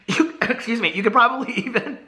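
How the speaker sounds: words broken up by real laughter; choking on real giggles; laughing hard. Real, not acted. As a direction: try to get the sentence out through laughs. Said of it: "I'm laughing so hard".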